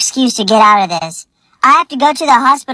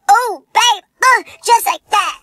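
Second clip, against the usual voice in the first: imitating dad; 9 semitones higher than usual. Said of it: "you were yelling at your friend".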